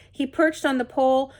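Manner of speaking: ordinary delivery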